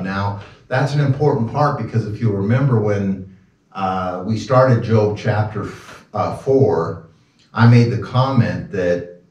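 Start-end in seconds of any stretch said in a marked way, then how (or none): none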